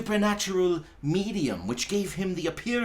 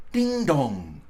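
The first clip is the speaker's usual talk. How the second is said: loud "Ding dong" falling like a tolling bell; bell chime; 4 dB louder than usual. A narrator's reading. Narrator sounding the three-quarter chime. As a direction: toll it out.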